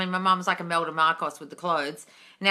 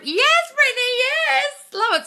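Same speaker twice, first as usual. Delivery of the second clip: much higher and louder, slow "yes"; cheerleader gush; 7 dB louder, slower than usual. Real, not acted. Streamer cheers Brittany's comment, high gushing yes.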